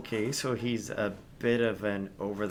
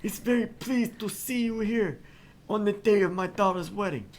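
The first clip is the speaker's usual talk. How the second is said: much higher, badly faked Italian mobster; hammed-up Godfather accent; 12 semitones higher than usual. Host greets the players in a badly faked Italian accent. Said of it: "what I have just done to the Italian accent".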